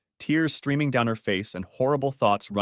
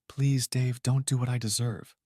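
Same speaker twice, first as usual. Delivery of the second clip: softly plead, a touch tearful; understated plea through quiet sobs. A narrator's reading. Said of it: "said softly between quiet sobs".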